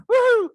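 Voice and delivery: loud, high celebratory woo-hoo; gleeful whoop. Retold or imitated. Traveler finally asked for other identification, gleeful whoop. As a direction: whoop like you just won. Celebrates getting the awaited question.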